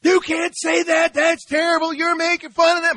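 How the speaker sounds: high, loud, indignant daughter's protest; shrill it out as the scandalised daughter; upset daughter. Retold or imitated. Daughter scolding her father in Harrods, high indignant shout. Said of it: "My, my daughter gets all upset".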